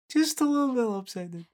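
very high, small silly voice; tiny high-pitched comic echo; comic squeaky voice. Pretend. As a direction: squeak it out playfully.

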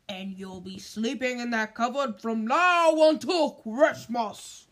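loud, slow menacing uncle; loud, slow furious uncle threat; threatening warning. Acted. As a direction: bark the threat right in the boy's face. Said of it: "putting his large purple face right up close".

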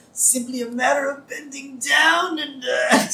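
high, lightly voiced old man; feeble old man. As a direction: play a frail old man straining. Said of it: "now he becomes a feeble old man".

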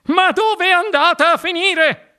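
high, squawking flustered woman. A narrator's reading. Mrs Salt flaps about her vanished daughter, squawking. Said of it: "starnazzando e agitando le braccia".

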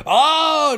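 loud, high cry of agony; pained cry. Acted. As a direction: cry out as if struck. Sinestro has heart ripped out.

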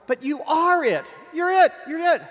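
preacher crying out to the congregation, full force; preaching shout. Real, not acted. Preacher hammering the point home.

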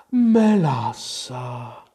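extremely slow, drawn-out word; drowsy creature drawl; sleepy Dormouse. A narrator's reading. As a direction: stretch it out as if dozing off.

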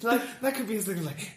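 ordinary talk

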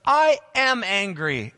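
slow, loud, stubborn declaration; sulky Jonah. Retold or imitated. Pastor voicing Jonah answering God, slow loud sulk. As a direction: dig in like a pouting prophet. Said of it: "And Jonah's like".

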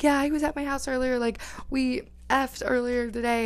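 imitating angry ex-girlfriend. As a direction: mimic her accusation, high but not shouted. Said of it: "yelling at him, yelling at me".

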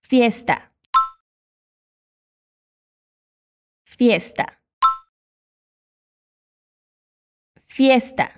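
"fiesta" with a closed middle e, like the e in você; Spanish fiesta. Shown as a demonstration. Teacher models for the learner to repeat, closed e.

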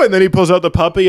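usual speaking voice

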